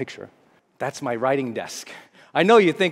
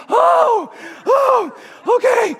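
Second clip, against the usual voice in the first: much higher, louder gasping relief; relieved exclaim; 7 dB louder, much slower than usual. Retold or imitated. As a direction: gasp it out in relief. Relief after writing number.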